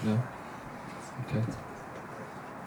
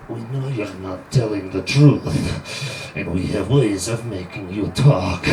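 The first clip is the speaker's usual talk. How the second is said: cool foreign spy menace; 5 semitones lower, slower than usual. Acted. Interrogator threatening.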